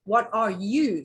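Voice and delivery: "you" stretched out slowly and singled out; stress on YOU. Shown as a demonstration. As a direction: lean on "you" and draw it out. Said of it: "put emphasis on each word separately".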